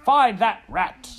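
very high, loud, slow command; drawn-out full shout, pitched high; shouted order. A narrator's reading. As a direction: shout each word as a royal order. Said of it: "shouted the king".

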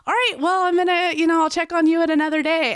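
high, light and quick, easing away; chirpy polite exit line, sped up; brightly excusing herself from a tense talk; breezy brush-off. Retold or imitated. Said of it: "I can just easily be like".

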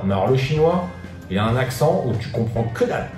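normal talking voice

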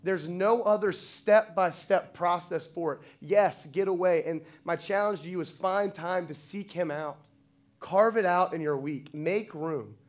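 preacher urging with full conviction; forceful preaching. Real, not acted. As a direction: drive the challenge home to the congregation. Preaches the closing challenge forcefully.

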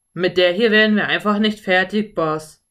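loud cowboy grumble; grumbling. A narrator's reading. Cowboy complains to his boss about the mare, grumbling. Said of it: "murrte einer der Cowboys".

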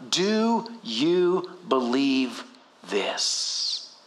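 very slow, low, word by word; solemn question. Acted. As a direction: let each word sink, dropping to a hush. Preacher voices Jesus' weighty question.

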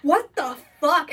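slow, high, drawn-out disbelief; mock shock. Imitating people hearing the couple's insults, drawn-out mock shock.

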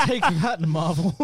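laughing loud and high; high laughing boast. Real, not acted. Mocking Marvel with a loud high laugh. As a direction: crow it through laughter.

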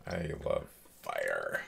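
creepy pyro voice. Pretend. Joking about loving fire.

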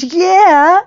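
high and loud, beaming; joyful high yes; excited confirmation. Retold or imitated. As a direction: proudly confirm it. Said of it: "He goes".

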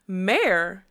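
loud, indignant demand; affronted, forceful question. A narrator's reading. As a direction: demand an answer, pitch leaping mid-word. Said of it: "she demanded".

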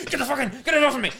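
high, loud mock panic about a snake; panicked yell, retold. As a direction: yell it off like something's on your leg. Panicked boss yelling, retold.

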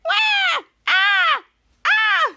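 very high, loud mock wails; cartoon yelps of pain; acted scream. Tiny character with spicy milk in eyes, mock screaming.

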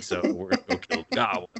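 high, quick mock bravado; rally them, half joking; gung-ho team voice. Team announcing goblin hunt, high mock bravado. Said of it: "their team's like".

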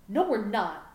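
loud, defiant shout; yelling back. A narrator's reading. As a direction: shout the refusal right back. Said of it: "Gohan yelled back".

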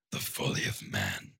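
a demon murmuring inside his skull; demon voice in his head. Pretend. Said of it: "he always has this voice in his head".

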